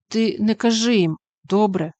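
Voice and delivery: plain reading voice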